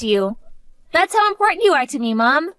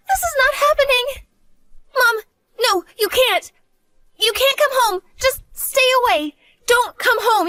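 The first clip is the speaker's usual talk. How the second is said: high, panicked and near tears, pleading with her mother; frantic, begging her mother to stay away; 5 semitones higher than usual. Acted. Daughter begs her mom to stay away, panicking.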